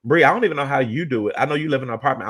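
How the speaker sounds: ordinary talk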